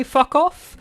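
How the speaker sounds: high-pitched half-said curse; high mock snap. Reyna almost swears at her sergeant, high. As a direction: start the curse and bite it off. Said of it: "about go to say".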